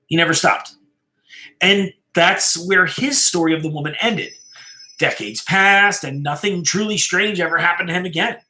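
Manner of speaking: ordinary delivery, nothing marked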